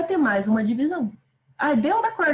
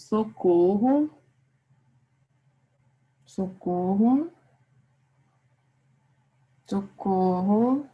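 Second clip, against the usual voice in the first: very slow "Socorro", each one drawn out with a rising end; slowly sounded out; much slower than usual. Shown as a demonstration. Teacher writing out the example for the class, slowly sounded out. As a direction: stretch "Socorro" syllable by syllable, lifting the last.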